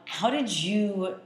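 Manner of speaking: normal talking voice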